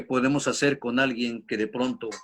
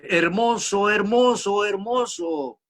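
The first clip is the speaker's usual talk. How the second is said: higher shouted word repeated like a cave echo; 6 semitones higher, slower than usual. Retold or imitated. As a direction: bounce it back three times. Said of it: "El eco le devuelve".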